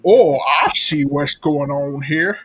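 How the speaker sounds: hammed-up comic movie impression, climbing to a high, drawn-out finish; Billy Madison. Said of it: "Adam Sandler and the Penguin".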